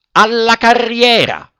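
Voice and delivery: high, loud, slow yell; shouted command. Acted. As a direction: drive the donkey on at full voice. Director calls out orders. (urlo acuto e lento)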